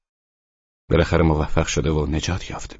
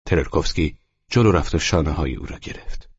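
plain reading voice

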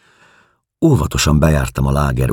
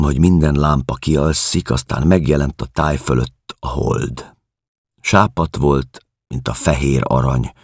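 ordinary delivery, nothing marked

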